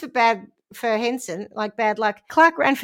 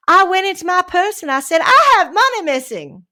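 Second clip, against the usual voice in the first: alarmed; 8 semitones higher, 6 dB louder than usual. Retold or imitated. Recalls alarm at missing money.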